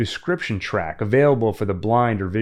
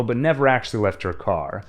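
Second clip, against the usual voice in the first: ordinary talk, nothing marked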